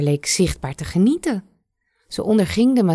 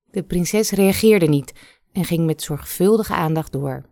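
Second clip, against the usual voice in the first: ordinary delivery, nothing marked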